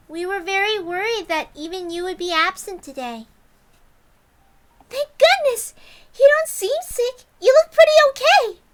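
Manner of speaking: put-on very high, loud girl voice; bubbly Rena. Rena relieved to see Keiichi, high and bubbly. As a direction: bounce with relief. Rena's lines.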